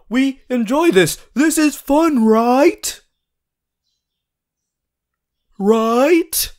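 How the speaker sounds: high, loud, pushy enthusiasm; forced cheer. Acted. Coaxing a bored study partner, high forced cheerfulness. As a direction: sell the fun a bit too hard.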